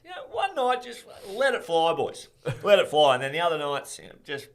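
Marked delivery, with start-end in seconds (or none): 0.0-3.1 high, hearty mock coach cheer; rallying coach telling the boys to let it fly; gung-ho coach. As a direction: egg the boys on like a fired-up coach. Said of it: "Ross pretty much gets up and goes".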